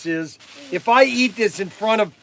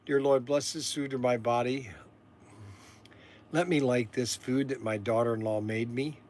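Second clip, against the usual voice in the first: mock prayer; 7 semitones lower, 10 dB quieter than usual. Mock grace before eating.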